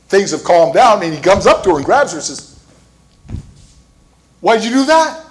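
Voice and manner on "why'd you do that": baffled question. Retold or imitated. Guy grabs her, baffled.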